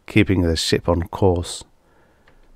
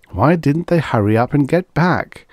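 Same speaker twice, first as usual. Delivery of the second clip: uneasy thought; 7 semitones higher than usual. A narrator's reading. Williams's growing unease.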